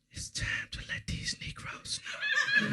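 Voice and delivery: put-on slow drunken whisper. Drunk sister whispering a warning at the party. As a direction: breathe it out slow and ominous. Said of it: "She grabbed my arm and said".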